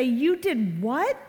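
slow disbelief with a rising swoop; shocked parent reaction. Acted. Parents reacting to their kids' confession, drawn-out disbelief. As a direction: stretch it out, incredulous. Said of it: "we look at them and say".

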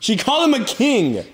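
high, loud, amazed; excited. Real, not acted. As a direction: announce it in delight. Excited retelling of reply.

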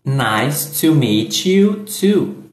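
slow, careful model line; slow demo read; slow model phrase. Shown as a demonstration. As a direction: spell it out slowly for the learner. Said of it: "teacher models the reply slowly".

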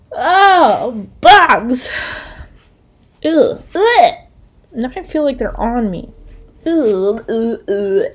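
loud disgusted groans. Real, not acted. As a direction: shudder with revulsion, "ugh". Shuddering in disgust at bugs.

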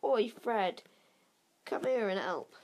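a twin yelling down the train for help; calling out. A narrator's reading. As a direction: shout for his brother to come. Twin calling his brother.

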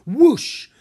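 big whooshing blast, high and loud. A narrator's reading. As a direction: voice the icy blast. Blast of freezing air.

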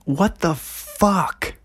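high, slow drawn-out what the fuck; astonished, high-pitched drawl; shocked disbelief. Real, not acted. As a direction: stretch it out in stunned disbelief. Shocked at actor's age.

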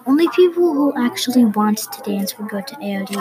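usual speaking voice

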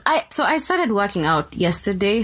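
ordinary talk

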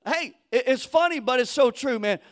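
normal talking voice

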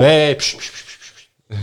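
loud "hey" dropping into hissed shushes; imitated hushing. Teller acting out someone alert to a noise, urgent hushing. Retold shushing after hearing something.

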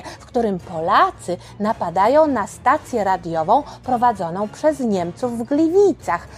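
ordinary delivery, nothing marked

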